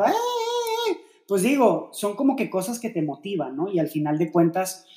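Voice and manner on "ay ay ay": high, drawn-out falsetto cheer; imitated cheering shout. Imitating girlfriend cheering in the football stands, high falsetto yell. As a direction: mimic a fan's long squeal from the stands, kept soft. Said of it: "la ves ahí en las gradas gritando".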